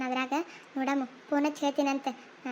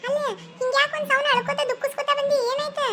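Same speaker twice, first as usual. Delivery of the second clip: higher, worried elder sister-in-law; a much higher voice checking on a sobbing girl; concerned sister-in-law; 9 semitones higher, 3 dB louder than usual. Acted. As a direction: ask her, higher-pitched, what happened. Sister-in-law greets crying Pinki.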